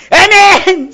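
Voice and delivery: loud, high congregational "Amen!"; mock-zealous amen shout. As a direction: belt out an amen as if answering the preacher. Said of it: "소리면 깜이나 떼깜이나 질렀지".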